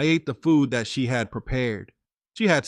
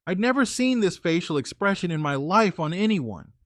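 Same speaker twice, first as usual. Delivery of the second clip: ordinary talk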